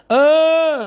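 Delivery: sudden loud high eh; loud exclamation. Real, not acted. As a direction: call out sharply to catch attention. Loud call out.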